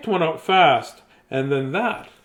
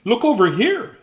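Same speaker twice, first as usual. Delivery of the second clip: bright call, pitched up; sister calling out; 7 semitones higher, 4 dB louder than usual. Acted. Sister calls to brother, raised excited call. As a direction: call out to get her brother's attention. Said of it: "said my sister Kay".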